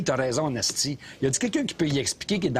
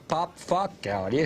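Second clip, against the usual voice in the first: normal talking voice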